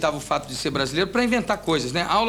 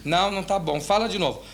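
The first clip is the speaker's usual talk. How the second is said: ordinary talk, nothing marked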